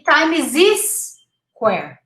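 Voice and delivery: time is this square as time-iz-IS-quare; wrong extra syllable. Shown as a demonstration. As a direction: mispronounce Times Square, time is this square. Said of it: "adding an extra syllable".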